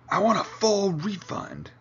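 high-pitched outraged demand; angry shout. Acted. Climber on the snow demanding a refund, shouting. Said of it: "the man shouted".

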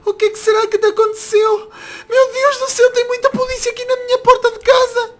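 high-pitched distressed worry; alarmed, close to crying; anxious alarm. Acted. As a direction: fret in a high, tearful voice. Finds police at his home.

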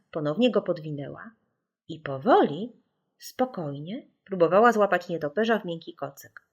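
ordinary delivery, nothing marked

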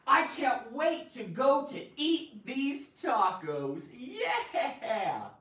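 excited. Shown as a demonstration. Teacher reading an example sentence about excitement. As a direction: read it like you can't wait to eat. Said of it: "And the sentence is".

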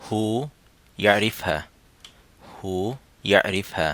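ইয়ারিফহা said the Saudi way, no উ before হা; Saudi pronunciation. Shown as a demonstration. Teacher drilling the Saudi form for students, Saudi pronunciation. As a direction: repeat হু ইয়ারিফহা Saudi-style. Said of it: "Saudi উচ্চারণে হবে".

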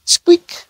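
tiny, very high mouse squeak; a sudden tiny squeak in the dark. Acted.

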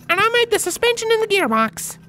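very high, bright filly voice; cheerful Apple Bloom. A narrator's reading. Apple Bloom greeting the ponies, high and cheerful. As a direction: greet them happily. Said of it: "Apple Bloom added".

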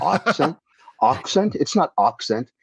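put-on puzzled echo, broad "ah" opening "accent"; misheard "accent". Midwesterners mishearing the New Yorker, "accent" as "ahk-sent". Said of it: "And they'd say".

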